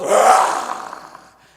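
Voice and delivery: sudden, loud monster roar; full-volume beast bellow; werewolf roar. Acted. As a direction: leap out with a startling roar. Werewolf leaps out from the curtain.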